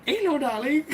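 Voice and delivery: squeaky high hello, sing-song; high falsetto. Retold or imitated. Customer greeting back in a high voice. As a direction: shoot the voice right up. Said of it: "And I was like".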